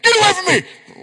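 loud, high mock rejection shout. Youth pastor mocking a stranger's rejection at church, loud shout. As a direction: yell it like a startled stranger pushing you off.

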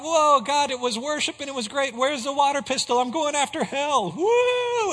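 high-pitched, fired-up whooping zeal; over-eager excitement. Acted. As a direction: play a gung-ho believer charging off, ending in a whoop. Mimics over-eager Christians after worship.

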